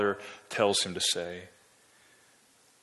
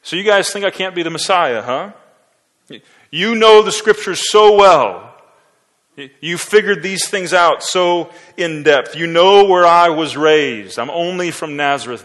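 sarcastic; 6 semitones higher, 10 dB louder than usual. Shown as a demonstration. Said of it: "a really sarcastic comment from the Savior".

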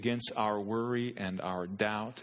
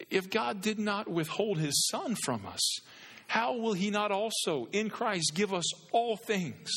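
preaching at full force; 9 semitones higher than usual. Acted. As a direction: build each not higher like a crescendo. Preaching Paul and Jesus.

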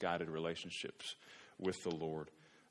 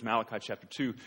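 usual speaking voice

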